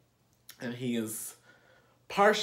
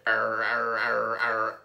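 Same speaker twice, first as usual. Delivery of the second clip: imitating a growl; 4 semitones lower than usual. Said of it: "He was like".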